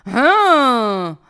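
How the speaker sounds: loud, drawn-out huh of disbelief; long falling, incredulous huh; incredulous "hein". Acted. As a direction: scoff at an absurd offer. Reacts to absurd palace offer.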